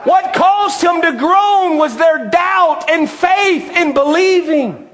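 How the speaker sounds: driving, ringing sermon delivery; impassioned preacher working the congregation; fervent preaching. Real, not acted. Preaches at full force.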